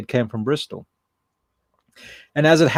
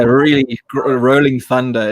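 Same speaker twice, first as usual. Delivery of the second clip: put-on Scottish accent; 5 dB louder, slower than usual. Imitates heavy Scottish voice described.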